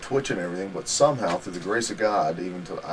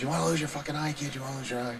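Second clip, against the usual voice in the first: threat imitated in a higher voice; retold threat; 6 semitones higher, 6 dB quieter, faster than usual. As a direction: play the wrestler threatening, pitched up without shouting. Retold threat during a fight.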